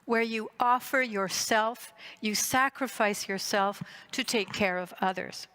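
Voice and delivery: normal talking voice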